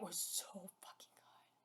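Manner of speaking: awed whisper. Real, not acted. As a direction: voice drops away to a whisper. Overwhelmed reaction to the fight.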